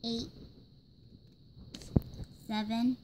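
usual speaking voice

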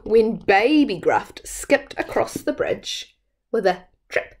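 usual speaking voice

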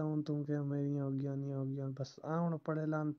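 normal talking voice

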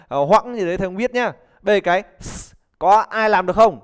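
normal talking voice

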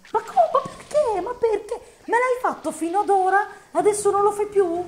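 very high, loud outraged protest; exasperated gamer fury; furious rant. Real, not acted. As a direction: shriek at the unfair game. Player raging at game.

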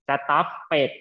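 slow "stop it", two separate beats; stopped misread in two syllables. Shown as a demonstration. Teacher points out the extra syllable, "stop it" said slowly in two beats. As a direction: stretch the mistaken "stop it" so both syllables show. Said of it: "มีสองพยางค์ ไม่เอานะ".

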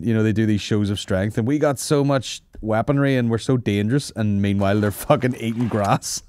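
usual speaking voice

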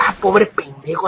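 low, soft scornful pity; quiet disdain, pitched well below normal; contemptuous. Acted. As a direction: look down on them and murmur it. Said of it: "véanlo con desprecio, como diciendo".

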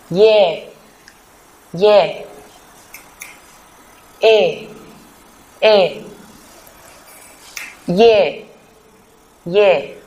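isolated syllables "e, ye, wa, we, wo, wi, ươi", each with a falling tone; pronunciation drill, each syllable twice with pauses for repeating; model vowel pronunciation. Shown as a demonstration. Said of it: "phát âm các nguyên âm này".

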